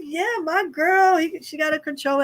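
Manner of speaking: high, stretched-out celebration; excited, high-pitched hype; cheering. Retold or imitated. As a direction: cheer for a friend in a lifted voice. Retells cheering for the winner.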